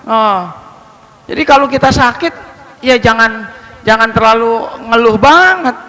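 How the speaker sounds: usual speaking voice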